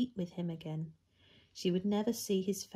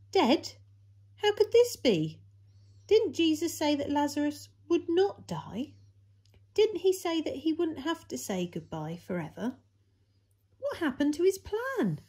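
much higher, louder stunned questions; shocked disbelief; 10 semitones higher, 6 dB louder than usual. Acted. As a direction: pile up the questions in alarm. Disciples shocked by Lazarus's death.

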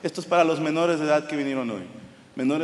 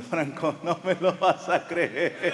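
slow words broken by laughter; can barely get it out for laughing; 3 dB quieter, much slower than usual. Retold or imitated. Milanés telling Franco the news, laughing. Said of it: "me dice riéndose".

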